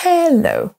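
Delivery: "Hello" as he-LLO, high then falling; second-syllable stress. Shown as a demonstration. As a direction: demonstrate "Hello" with weight on LO. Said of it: "c'est la même chose pour hello".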